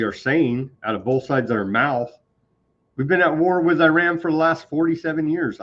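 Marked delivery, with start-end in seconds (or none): 3.0-5.6 higher-pitched mimicry of a talking point; mock official claim. Host mimicking officials' war claim, mockingly. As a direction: parrot the official line with mock earnestness.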